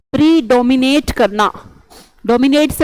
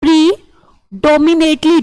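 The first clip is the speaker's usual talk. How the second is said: slow teaching; 2 semitones higher, slower than usual. Shown as a demonstration. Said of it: "teacher spelling out word".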